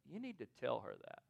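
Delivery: soft, low, matter-of-fact prompting; God. Retold or imitated. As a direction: voice a quiet inner nudge. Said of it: "I hear God go".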